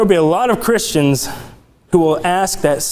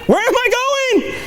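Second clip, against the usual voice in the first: lost driver; faster than usual. Pretend. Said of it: "You look back to it".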